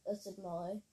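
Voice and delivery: usual speaking voice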